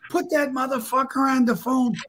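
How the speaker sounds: high-pitched angry demand, retold; hollering like crazy. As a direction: imitate an irate caller demanding someone. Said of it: "Willie's hollering like crazy".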